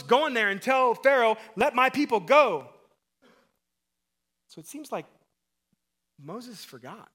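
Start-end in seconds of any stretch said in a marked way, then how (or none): 0.0-2.7 much louder, higher, "Let my people go" thundered; forceful command. Retold or imitated. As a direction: shout God's order with full authority. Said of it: "God told Moses".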